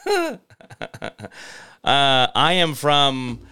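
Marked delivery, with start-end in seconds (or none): 1.8-3.4 put-on Spanish accent. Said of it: "John says".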